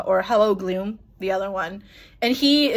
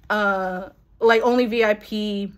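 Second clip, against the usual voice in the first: normal talking voice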